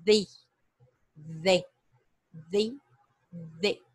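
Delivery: THE with tongue between the teeth; each THE isolated, with a careful voiced TH; TH pronunciation drill. Shown as a demonstration. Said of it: "a língua entre dentes, dá uma sopradinha".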